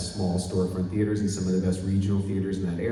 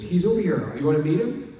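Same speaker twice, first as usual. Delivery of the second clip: higher, louder eager offer; 6 semitones higher, 4 dB louder than usual. Retold or imitated. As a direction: invite him excitedly. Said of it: "He goes".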